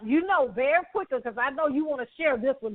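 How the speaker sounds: ordinary talk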